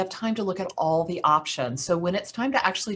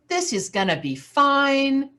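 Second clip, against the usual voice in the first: relieved; 7 semitones higher than usual. Retold or imitated. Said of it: "She finally starts to relax".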